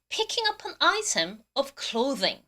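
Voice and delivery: very slow, careful English; slow model reading. Shown as a demonstration. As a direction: spell it out slowly for the class. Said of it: "발음 한 번 더".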